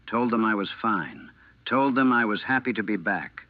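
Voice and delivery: ordinary delivery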